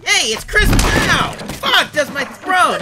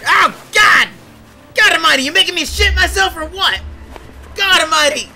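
raised, higher exclamations; alarmed yelling; 11 semitones higher, 11 dB louder than usual. Acted. Victim startled by the intruder, alarmed yelling. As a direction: cry out in startled protest.

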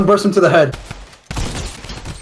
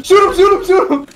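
panicking gamer; 11 semitones higher, 4 dB louder than usual. Real, not acted. Urging teammate mid-fight.

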